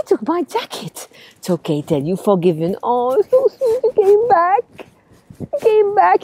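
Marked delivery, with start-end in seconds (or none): 2.8-6.2 high, fond, drawn-out cooing; hammed-up delight. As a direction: coo over the returned teddy. Teddy returns.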